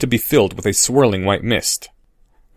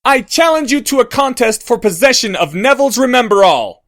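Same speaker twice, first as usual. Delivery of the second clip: much higher, loud formal challenge; 12 semitones higher, 4 dB louder than usual. Acted. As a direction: call out the challenge for all to hear. Harry challenging Goyle across field.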